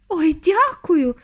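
high, loud, drawn-out gratitude; delighted high exclamation; overjoyed thanks. A narrator's reading. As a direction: gush with relief. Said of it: "зраділа пані Тіві".

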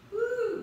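a high, loud whoop of energy; excited whoop. Real, not acted. Coach whooping loudly to energize the class. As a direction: whoop to lift the room. Coach cheering between rounds.

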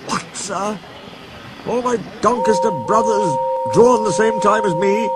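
high, alarmed questioning; shocked dismay. Acted. Gordon learning his brothers are gone, shocked and high. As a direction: disbelief rising in the voice.

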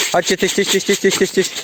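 high sing-song call to the birds; calling ducks. Real, not acted. Farmer calls his ducks to come eat, high sing-song calling. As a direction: call them over with quick high tyś tyś.

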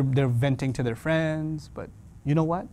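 ordinary delivery

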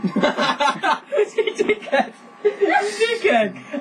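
laughing high-pitched insult. Real, not acted. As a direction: cackle it out.

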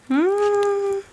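high appreciative hum; delighted mmm. Real, not acted. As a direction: savor it aloud. Savoring dessert smell.

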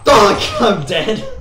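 loud yell. Real, not acted. Dies in game, yells.

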